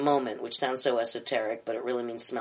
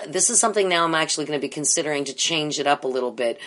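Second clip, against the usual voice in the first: usual speaking voice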